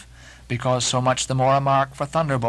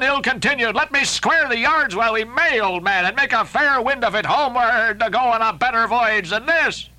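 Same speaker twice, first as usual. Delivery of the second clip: higher, urgent begging voice; Starbuck pleading; 7 semitones higher than usual. Acted. First mate Starbuck begging Ahab to sail home, urgent. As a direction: beg him to turn back, desperate.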